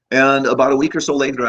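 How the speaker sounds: ordinary talk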